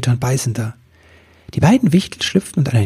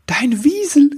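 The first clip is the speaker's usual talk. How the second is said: much higher, bursting with enthusiasm; thrilled exclamation; 14 semitones higher than usual. A narrator's reading. Elf spotting friend's weasel, calling out enthusiastically. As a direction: cry out a sudden bright idea. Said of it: "rief er voller Begeisterung".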